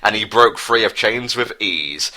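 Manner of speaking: ordinary talk, nothing marked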